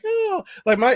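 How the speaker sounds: put-on high sugary "Oh" of a toddler on best behavior; sweet kid voice. Toddler acting sweet for grandma.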